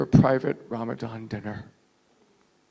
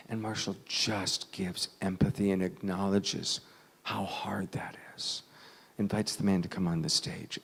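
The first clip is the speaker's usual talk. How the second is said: ordinary talk, nothing marked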